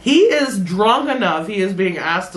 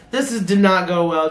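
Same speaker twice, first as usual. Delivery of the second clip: normal talking voice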